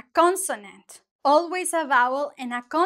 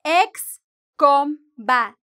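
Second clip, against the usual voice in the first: "ex combatiente" broken into separate beats, slow and emphatic; "ex combatiente" chopped slowly for a learner; syllable by syllable; 6 dB louder, slower than usual. Shown as a demonstration. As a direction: pause after each syllable of "ex combatiente". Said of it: "so we have one syllable".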